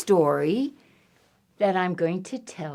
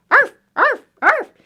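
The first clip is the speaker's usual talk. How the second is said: high, loud short yaps; loud high-pitched arfs; dog bark; 7 dB louder than usual. Acted. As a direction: bark like an excited small dog. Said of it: "a lot of dogs like water".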